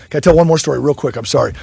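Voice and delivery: ordinary delivery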